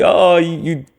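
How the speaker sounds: mock goofy ribbing among the boys; goofy shit talk. Guys ribbing each other, mock goofy banter. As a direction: loosely imitate bros trash-talking. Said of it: "you know, just shit talking".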